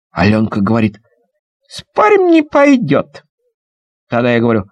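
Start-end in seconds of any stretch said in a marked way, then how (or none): 1.7-3.2 put-on much higher, girlish challenge; a little girl teasing a bet; girl's voice. Girl bets, high voice. (высоким девчачьим голосом)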